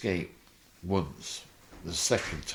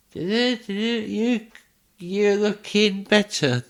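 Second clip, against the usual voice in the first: much higher, much louder, slow ad voice; mock advert voice; 8 dB louder than usual. Friend [name] mocking him with a claims advert. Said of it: "and he's going".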